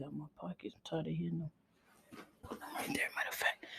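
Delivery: whispered aside. Real, not acted. Aside while pulling keys out.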